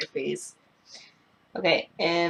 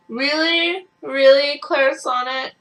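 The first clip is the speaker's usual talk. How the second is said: exasperated disbelief; 7 semitones higher, 5 dB louder, slower than usual. Real, not acted. Brush sheds, exasperated disbelief.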